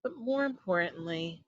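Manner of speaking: ordinary delivery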